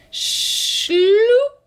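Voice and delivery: high, rising sloop; high sliding sound. A narrator's reading. Reading the sack noise to kids, high and sliding.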